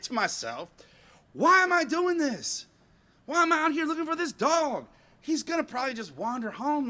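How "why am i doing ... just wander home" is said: acted-out fed-up self-questioning; exasperated. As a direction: wail the question in mock despair. Acted complaint to himself while searching.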